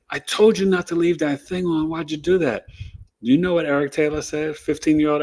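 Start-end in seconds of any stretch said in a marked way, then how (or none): 0.1-2.7 high, raised mother scolding; pissed-off mom. Retold or imitated. Mother scolding her son about the shower, high and angry. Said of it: "Cheryl Taylor was pissed".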